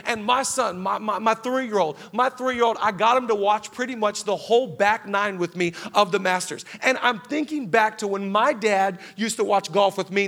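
ordinary talk

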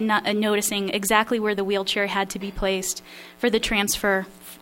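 plain reading voice

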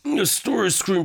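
put-on frail elderly man clearing his throat; very old man. Shown as a demonstration. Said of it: "like that, right?".

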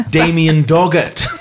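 high, slow, laughing announcement; drawn out through laughter. Real, not acted. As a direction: crack up while revealing a ridiculous name. Cracking up at character's name.

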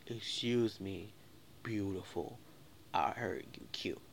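slow, low imitation of a flirting man; lightly voiced slow-talking admirer; mock pick-up voice. Imitating a man's pick-up line.

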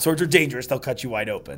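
ordinary talk, nothing marked

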